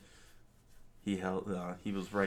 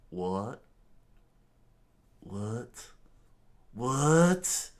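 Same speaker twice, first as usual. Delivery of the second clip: shocked disbelief; 4 semitones higher, 8 dB louder, much slower than usual. Acted. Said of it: "Don Krieg screamed out".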